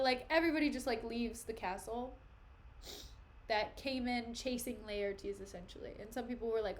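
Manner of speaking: ordinary talk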